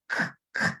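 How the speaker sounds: bare k sound, no vowel; teacher demonstrating the k sound; hard C sound. Shown as a demonstration. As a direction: say "k" as an isolated consonant, pausing between. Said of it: "is the original sound".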